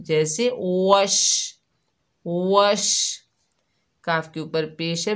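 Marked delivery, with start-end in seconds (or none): 0.0-3.2 वश with a long spreading sh, said very slowly; spreading sh sound. Shown as a demonstration. Teacher demonstrates the spreading शीन in वश, slow drawn sh. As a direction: let the शीन in वश spread and hiss out. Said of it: "शीन की आवाज़ फैलती हुई महसूस होगी".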